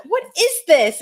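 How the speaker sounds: high, loud mock bafflement; incredulous question. Co-host re-enacting her reply to a baffling video, loudly incredulous. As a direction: demand an explanation in exaggerated disbelief.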